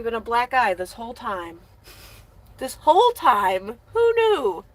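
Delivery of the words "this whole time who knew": loud, high mock astonishment; mock surprise.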